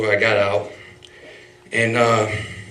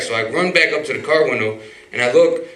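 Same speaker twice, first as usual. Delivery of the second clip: ordinary talk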